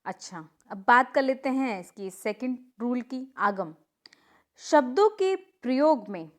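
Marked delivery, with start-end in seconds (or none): 4.6-6.3 slow dictation. Shown as a demonstration. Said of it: "teacher reads the rule slowly".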